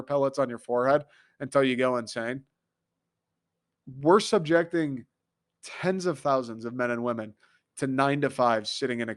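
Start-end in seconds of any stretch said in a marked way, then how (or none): none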